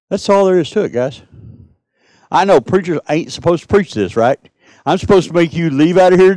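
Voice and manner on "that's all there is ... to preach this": ordinary delivery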